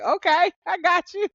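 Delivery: God saying yes with a grin; voicing God. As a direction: answer brightly as God granting the prayer. Voicing God's answer.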